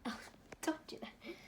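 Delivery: hushed whisper. Real, not acted. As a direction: breathe the words without voice. Whispered aside off camera.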